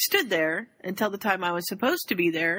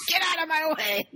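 mock-grumpy high snap; 9 semitones higher than usual. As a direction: bark it half-joking. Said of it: "in a bad mood today".

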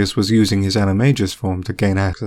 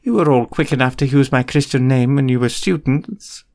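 higher, arch teacher voice; prim McGonagall; 4 semitones higher than usual. A narrator's reading. McGonagall to Remus about her name, arch higher voice. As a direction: chide him lightly.

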